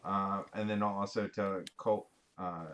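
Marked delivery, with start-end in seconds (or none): none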